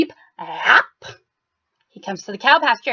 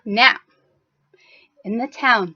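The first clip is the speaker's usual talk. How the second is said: plain reading voice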